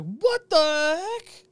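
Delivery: high, drawn-out disbelief; hammed-up incredulous reaction; mock shock. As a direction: exclaim it in slow, high-pitched astonishment. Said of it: "and I was like".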